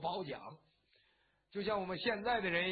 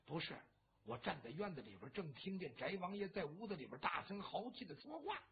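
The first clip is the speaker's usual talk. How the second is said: hushed secret report; 2 semitones lower, 8 dB quieter than usual. Acted. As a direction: lean in and keep it from being overheard. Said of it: "压低了声音". (压低声音偷偷禀报)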